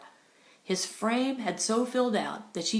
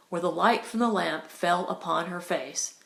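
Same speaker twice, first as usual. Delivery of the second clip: usual speaking voice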